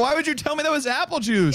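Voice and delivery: high, laughing complaint; laughing accusation. Real, not acted. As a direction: laugh through it.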